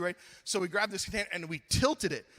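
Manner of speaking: usual speaking voice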